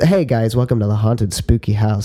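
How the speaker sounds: normal talking voice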